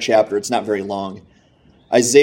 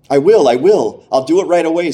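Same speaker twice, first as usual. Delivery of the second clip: higher, louder burst of eagerness; storyteller's excited yes; eager agreement; 5 semitones higher, 5 dB louder than usual. A narrator's reading. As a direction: agree instantly, like someone desperate to obey. Relieved man eagerly agrees.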